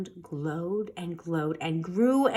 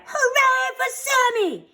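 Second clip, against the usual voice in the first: much higher, slow cheering; 3 dB louder than usual. A narrator's reading. The whole neighborhood to Sammy, cheering high. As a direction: cheer for the hero. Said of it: "everyone cried".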